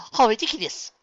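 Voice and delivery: loud, high, fake amusement; forced laughing exclamation. A narrator's reading. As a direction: exclaim it overloud, as if covering up. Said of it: "Luna laughed, quite loudly and unnaturally".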